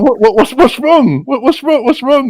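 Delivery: high, loud, stammering bewilderment; bewildered protest. Retold or imitated. Man asks furious woman what's wrong, high-pitched bewilderment. Acting his bewildered reply to her.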